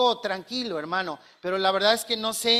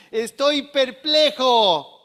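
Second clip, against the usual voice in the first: slow, louder, drawn-out puzzlement; bewildered apostle; 3 semitones higher, 6 dB louder, much slower than usual. Acted. Pablo telling the Galatians, slow and puzzled. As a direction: stretch out perplejo in disbelief.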